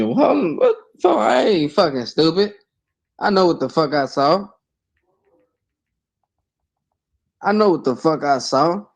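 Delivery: high-pitched retold protest; retold outrage. Guest retells seeing the screenshot, high and worked up. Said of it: "I'm looking".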